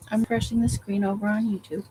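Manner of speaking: ordinary delivery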